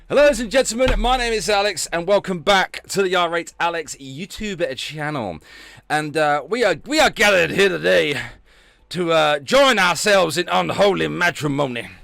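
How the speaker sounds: high, loud announcer-style welcome; channel intro. Pretend. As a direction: belt out a mock-grand greeting to the viewers.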